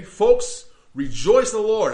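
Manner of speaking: Folks rejoice in the Lord shouted high and strong; loud command. Real, not acted. Preacher commanding his congregation to rejoice, loud. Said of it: "imperative. You need to do this".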